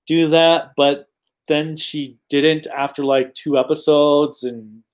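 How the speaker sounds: normal talking voice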